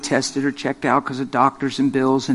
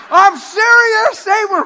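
high, loud and slow, stretched out; fired up; 7 dB louder, slower than usual. Retold or imitated. Girls insisting to the preachers, loud and excited. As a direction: blast it with excitement. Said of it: "They're like".

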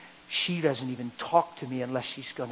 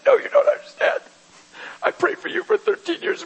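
much higher confessing wife voice; tearful wife; 8 dB louder than usual. Retold or imitated. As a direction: plead it as the broken wife.

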